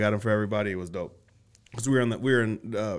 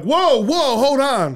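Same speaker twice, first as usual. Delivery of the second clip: alarmed protest; 11 semitones higher, 6 dB louder, much slower than usual. Retold or imitated. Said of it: "They're like".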